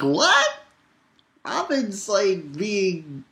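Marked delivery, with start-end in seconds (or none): 0.0-0.5 surprised what. Retold or imitated. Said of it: "I was like".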